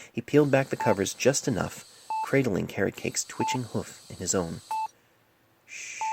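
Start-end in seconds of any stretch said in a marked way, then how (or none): 5.6-6.0 soft breathy shh; helpless hush. A narrator's reading. Painless soothing the dying patient, a soft hush. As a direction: hush him gently. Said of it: "he offered helplessly".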